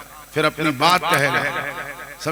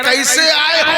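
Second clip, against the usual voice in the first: high, louder searching question; pitched-up voice of the saint asking; saint's question; 11 semitones higher, 6 dB louder, slower than usual. Retold or imitated. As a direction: ask it high and loud, probing. Saint questions the visiting disciple.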